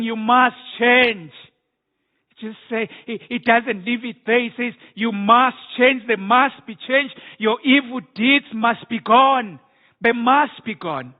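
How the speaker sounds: forceful prophetic demand; preaching God's command. Acted. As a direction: command change from the pulpit.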